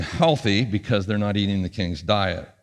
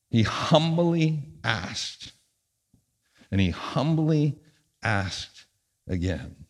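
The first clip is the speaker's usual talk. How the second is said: humble; 5 dB quieter, slower than usual. Acted. Preacher stressing humility.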